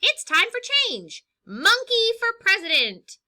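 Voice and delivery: high, loud rally cry; excited campaign shout. Acted. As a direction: rouse the crowd for change. Monkey campaign chant.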